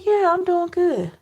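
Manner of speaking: lightly voiced younger self, reassuring; younger self's reply. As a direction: pitch up to play herself reassuring grandpa. Her younger self answering grandfather.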